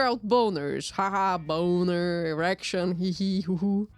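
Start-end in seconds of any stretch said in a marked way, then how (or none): none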